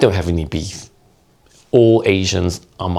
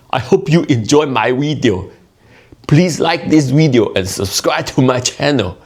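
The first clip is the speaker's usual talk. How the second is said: high, forceful mock Cantonese accent; 7 semitones higher, 3 dB louder than usual. Shown as a demonstration. Comedian asking viewers for likes without grammar, Cantonese accent. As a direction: pitch it up into a punchy Cantonese accent. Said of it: "do that for you in my Cantonese accent".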